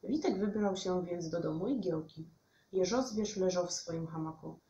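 plain reading voice

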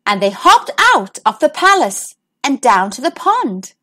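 ordinary delivery

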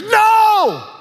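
sharp high shouted no; Peter's shout. Retold or imitated. Peter refusing Jesus's death, high shouted no. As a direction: yell the refusal high and hard.

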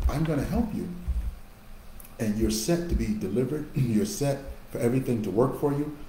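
normal talking voice

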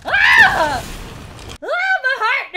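high, loud shriek, then a gasped-out cry; startled scream. Real, not acted. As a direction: cry out from a racing heart. Jump scare.

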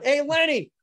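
calling out. Retold or imitated. Gym owner calling Lenny over to take the call, loud call.

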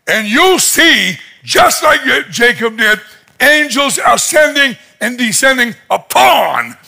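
high, emphatic preacher's proclamation; proclaiming. Retold or imitated. Jesus promising Nathaniel open heaven, proclaiming. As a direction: build it like a grand promise.

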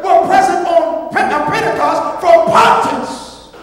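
high, loud preaching with drawn-out phrases; full-force preaching. Real, not acted. Preacher proving new birth to congregation, full-force preaching. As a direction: hammer each name and phrase home.

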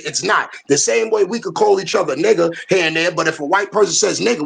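ordinary delivery

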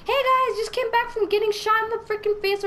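high, loud bubbly vlogger parody; mock selfie greeting. Player posing for selfie with victim, high mock vlogger greeting. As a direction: chirp it loudly to imaginary followers.

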